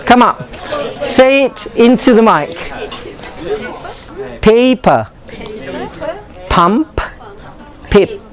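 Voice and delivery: ordinary delivery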